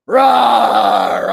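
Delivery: loud mock monster roar; hammed-up loud rawr; Bowser roar. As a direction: roar like a cartoon beast. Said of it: "fighting Bowser".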